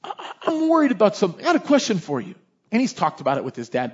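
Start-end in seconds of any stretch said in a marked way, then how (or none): 0.0-2.3 put-on higher, hesitant young child voice; child with a troubling question; worried little boy. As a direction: imitate an anxious small kid. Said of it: "This is like five-year-old, six-year-old".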